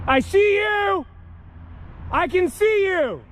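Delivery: high, strained yell; calling out. Real, not acted. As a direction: yell into the dark at them. Calling out at figures.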